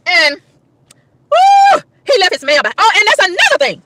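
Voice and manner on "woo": very high, loud whoop; disgusted woo. Real, not acted. Woman disgusted by mover, exasperated woo. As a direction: shriek a revolted "woo". Disgust at what was left behind.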